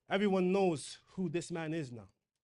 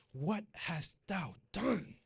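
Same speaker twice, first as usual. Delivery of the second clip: slow rebuke; 3 dB quieter, much slower than usual. Acted. Samuel rebuking Saul in scripture.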